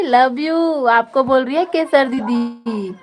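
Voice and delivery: ordinary talk, nothing marked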